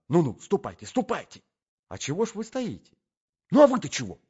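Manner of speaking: high, agitated shooing; agitated urging. Acted. Gentleman shoos the young man off the stairs, agitated.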